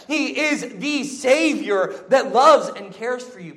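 slow, loud pleading exhortation; preaching. Acted. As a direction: urge them, slow and strong. Urgent altar-call exhortation.

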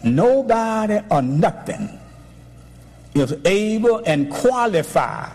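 "Nobody" को ऊँचा खींचकर प्रचार; preaching. Acted. Preaching at full force.

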